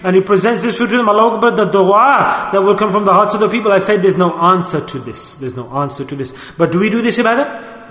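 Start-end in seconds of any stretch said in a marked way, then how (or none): 5.4-6.3 dropped low and soft, almost to himself; quiet echo. Real, not acted. Preacher repeats it to himself in awe, softly. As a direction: let the repeat fall away quietly. Repeated line, second take softer.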